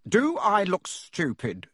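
slow snarl, pitched high. A narrator's reading. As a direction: sneer the question. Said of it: "snarled Uncle Vernon".

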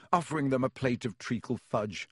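ordinary delivery

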